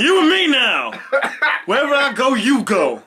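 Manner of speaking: high, loud Scared Straight bark; yelling mock-tough. Riffing on a friend clinging like a scolded kid, mock yell. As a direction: act out a yelling prison intimidator. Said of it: "kids that got yelled at".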